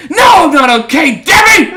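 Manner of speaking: high shouted panic; panicked shout. Retold or imitated. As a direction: yell back in panic. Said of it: "I'm like".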